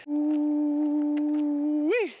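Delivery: high, drawn-out ooh-wee; excited whoop. Real, not acted. Runner whooping to himself on the cool-down hill, high and giddy. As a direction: let out a long giddy whoop. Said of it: "that was unnecessary".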